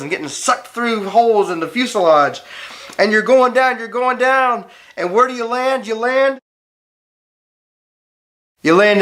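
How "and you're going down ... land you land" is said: higher, building suspense as the plane falls; story voice lifted high and urgent; dramatic storytelling. A narrator's reading. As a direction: narrate the crash with rising tension.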